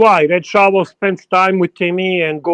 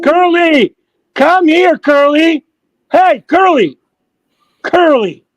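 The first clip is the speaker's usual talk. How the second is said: high, loud call across the room; 8 semitones higher, 4 dB louder, slower than usual. Real, not acted. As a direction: shout for Curly to come over. Calling Curly to join voice chat.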